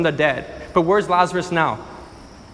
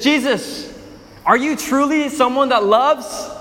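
challenging crowd; 6 semitones higher than usual. Acted. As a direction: throw the doubt right at him. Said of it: "the crowd takes it a step further".

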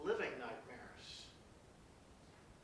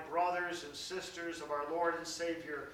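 ordinary talk, nothing marked

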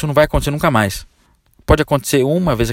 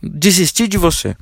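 higher, slow, mock-dramatic; dramatic breakup line; 5 semitones higher, slower than usual. Salesman's email title to client, mock dramatic. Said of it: "Esse é o título do e-mail".

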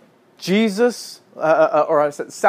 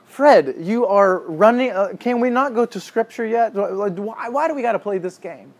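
higher, exasperated complaint to the author; mock annoyed protest; 7 semitones higher than usual. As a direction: playfully whine at Fred as if fed up. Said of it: "I was starting to get annoyed".

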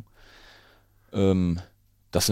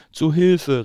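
much higher, louder panicked call; fox crying for help; 11 semitones higher, 11 dB louder than usual. Acted. Fox panicking at the Gruffalo, loud cry for help. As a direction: shout for help as the scared fox. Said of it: "rief er".

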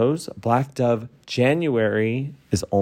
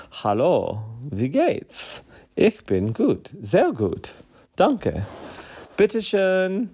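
a light playful German tourist patter, rising at the end; lightly voiced German small talk; mock German phrases; 4 semitones higher, slower than usual. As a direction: show off basic German cheerfully, pitch climbing on the last words. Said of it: "my German can get me by".

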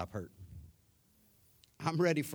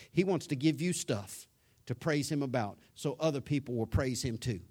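ordinary delivery

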